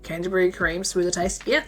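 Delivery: ordinary talk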